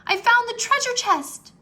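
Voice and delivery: high, loud excited shout; boy cries out finding the chest; excited cry. A narrator's reading. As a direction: shout with joy at the find. Jack excited at find.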